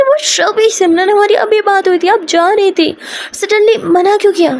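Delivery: high-pitched, startled confrontation; indignant disbelief. Acted. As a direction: confront a partner, taken aback and upset. Confronting partner over cancelled trip.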